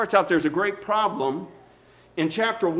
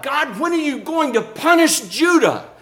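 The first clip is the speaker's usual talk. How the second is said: high, raised-voice indignant plea; crying out to God; 7 semitones higher than usual. Acted. Prophet demanding that God punish Judah, crying out. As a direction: cry out in righteous protest, pitched high. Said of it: "he's crying out to God".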